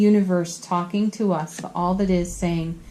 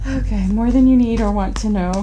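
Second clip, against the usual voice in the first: laughing, louder and punchy; giggly; 3 semitones higher, 4 dB louder than usual. Real, not acted. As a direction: giggle through the overshare. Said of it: "punchy and tired".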